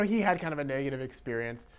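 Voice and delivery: ordinary talk, nothing marked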